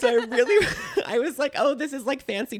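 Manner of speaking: giggling through the words; high, laughing retelling. Real, not acted.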